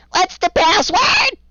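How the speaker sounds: very high, slow, shrill goblin shout from the wall; creature guard shouting. Acted. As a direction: yell down at them from the wall like a gleeful little creature. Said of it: "one of them switches to common and goes".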